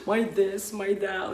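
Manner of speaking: high, wailing lament, voice cracking; imitating people crying in meditation; mock crying. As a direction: sob it out like someone overwhelmed. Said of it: "and then they start crying".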